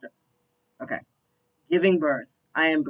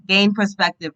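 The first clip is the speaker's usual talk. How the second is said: punched, higher declaration; loud command; 6 semitones higher, 11 dB louder than usual. Real, not acted. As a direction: bark it at the viewers. Blunt message to viewers.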